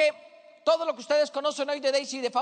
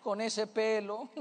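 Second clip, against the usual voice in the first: softer mock disapproving remark; judgmental onlooker; 3 semitones lower, 6 dB quieter, much slower than usual. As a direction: lightly play a passer-by passing judgement. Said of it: "la gente se para ahí y dice".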